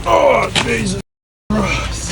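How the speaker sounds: high strained gasp, then breathless "Christ"; groaning under a heavy lift; straining under heavy load. Real, not acted. Lifting heavy post, straining.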